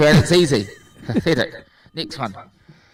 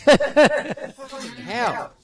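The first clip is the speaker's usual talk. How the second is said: exasperated groan; 8 semitones higher, much slower than usual. Real, not acted. Frustrated opening packaging.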